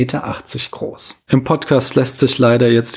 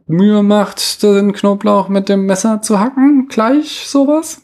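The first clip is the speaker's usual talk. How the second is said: unsure, questioning; 6 semitones higher than usual. Real, not acted. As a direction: grope through the sentence, ending on a high question. Unsure while rebuilding the negated example.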